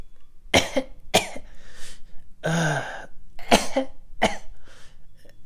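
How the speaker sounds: soft faked coughing; mock throw-up coughs, kept quiet; fake cough. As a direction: fake retching coughs to fool the boss. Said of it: "so I was just like".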